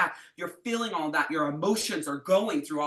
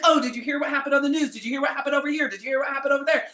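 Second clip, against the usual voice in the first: high, loud, rapid gossip chatter; mock a friend who won't stop chattering; mocking a chatterbox, high, loud and fast; chattering Gemini; 8 semitones higher, 6 dB louder, faster than usual.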